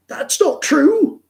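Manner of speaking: very high, loud, slow mock protest; imitating Anu. Tennis partner flatly denying the trials point, very high mock voice. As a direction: drag it out in indignant denial.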